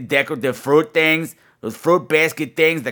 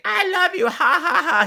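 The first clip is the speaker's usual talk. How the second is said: much higher squeaky toy voice with a giggle; talking teddy bear. Retold or imitated. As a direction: imitate a pull-string teddy bear. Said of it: "didn't say".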